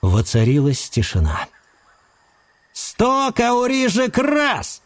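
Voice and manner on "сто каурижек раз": slow, high auctioneer chant; auctioneer call. Acted. Auctioneer calling bids.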